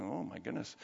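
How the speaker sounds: low, very soft aside; taken-aback muttering. Retold or imitated. Timid pastor watching a bold evangelist, quietly taken aback. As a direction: murmur it under your breath, taken aback. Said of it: "I'm, like, sitting in my chair going".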